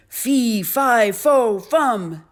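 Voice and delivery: loud booming chant, pitch stepping down; giant's shout. A narrator's reading. Giant suddenly appearing before Jack, booming shout. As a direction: bellow each word separately like a giant.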